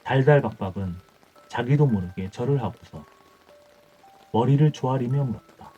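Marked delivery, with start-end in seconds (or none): none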